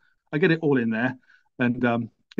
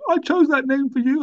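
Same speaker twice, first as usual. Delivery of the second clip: high, upset mother; a hurt mum, pitched way up; mum's voice; 13 semitones higher, 4 dB louder than usual. Retold or imitated. Mum voiced in son's story.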